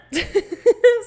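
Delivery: high, loud burst of laughter. Real, not acted. Laughing at a voice actor's perfect reply. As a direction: let a real laugh burst out.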